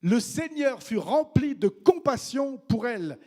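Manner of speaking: stately scripture reading; solemn reading. Acted. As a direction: lift the verse, slow and reverent. Verse reread over music.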